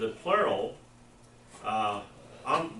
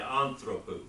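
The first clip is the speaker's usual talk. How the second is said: Anthropos with the stress on the first syllable, AN-thropos; teacher models Anthropos, hitting the opening AN; accent at the beginning; slower than usual. Shown as a demonstration. Teacher showing the class to stress the start of Anthropos. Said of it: "Put the accent at the beginning".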